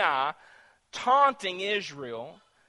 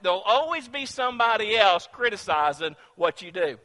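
ordinary delivery

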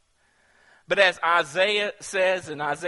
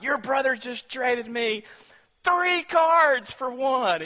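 put-on high, slow, thrilled kid's brag; excited boast; 8 semitones higher, 5 dB louder, slower than usual. Boy bragging to the brother about his trade, high and thrilled. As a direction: crow about the great trade, drawn out high. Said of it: "I was excited".

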